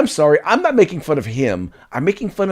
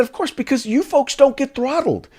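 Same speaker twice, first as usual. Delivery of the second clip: retold retort to friends; 8 semitones higher than usual. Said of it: "And I said".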